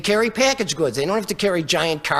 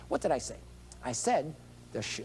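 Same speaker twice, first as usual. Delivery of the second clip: normal talking voice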